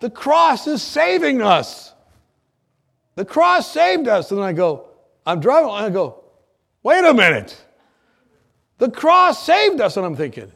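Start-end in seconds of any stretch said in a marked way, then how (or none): 0.0-4.3 realization. Retold or imitated. Sudden realization on road. 6.8-9.9 realization. Retold or imitated. Sudden realization.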